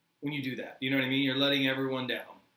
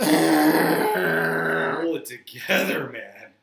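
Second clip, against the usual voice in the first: exasperated; 9 dB louder, much slower than usual. Pretend. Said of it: "exasperated plea".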